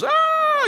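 very high-pitched pretend shriek; mock girlish scream. Preacher imitating terrified fishermen, high mock scream. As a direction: scream like a frightened little girl, in jest. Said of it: "screaming like little girls".

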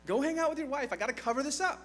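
exasperated plea. Retold or imitated. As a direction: whine at honorable Uriah in frustration. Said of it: "And David's like".

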